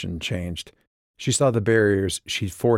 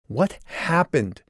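slow, higher, insistent demand; 8 semitones higher, slower than usual. A narrator's reading. Calliope to Joshua, slowly demanding the truth. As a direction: press him hard for the answer. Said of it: "Calliope demanded".

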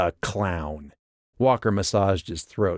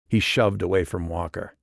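ordinary delivery, nothing marked

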